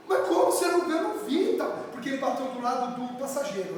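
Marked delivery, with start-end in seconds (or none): 0.1-1.0 father's louder, higher demand. Retold or imitated. Father confronting his daughter about the dented car, loud. 1.0-1.5 high protest. Pretend. Insisting to father.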